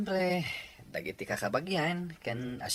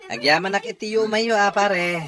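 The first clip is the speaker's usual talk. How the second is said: louder and higher, booming gratitude; hearty loud thanks; 6 semitones higher, 10 dB louder than usual. Acted. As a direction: welcome your buddy with a big hearty thank-you. Celebrant thanks arriving friend. (napigsa ken naragsak a panagyaman)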